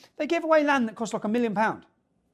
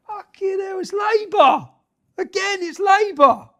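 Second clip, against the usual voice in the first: high, hammed-up shocked cry, louder on Labour; mock astonishment; 13 semitones higher, 8 dB louder, slower than usual. Sneering at Labour's accusers with mock shock. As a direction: pretend to be stunned by the obvious answer.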